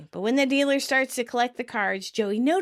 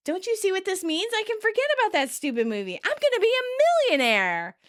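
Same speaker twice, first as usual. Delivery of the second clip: excited; 10 semitones higher than usual. Retold or imitated. Joey to Chandler, giddy about becoming a millionaire. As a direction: gush about the big plan. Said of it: "Joey excitedly says".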